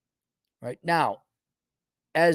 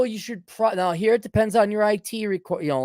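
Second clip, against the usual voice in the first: ordinary talk, nothing marked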